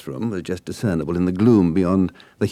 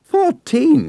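shrieked, much higher and louder; 9 dB louder than usual. A narrator's reading. Nessie shrieks at the boy's age, horrified. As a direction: shriek it in disbelief. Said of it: "she practically shrieked".

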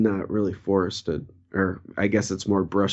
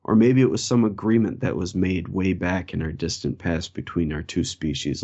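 ordinary talk

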